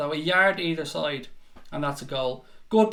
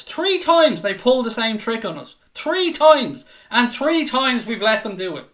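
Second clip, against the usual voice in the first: exasperated outburst; 5 semitones higher, 6 dB louder than usual. Real, not acted. Exasperated at repeated defending.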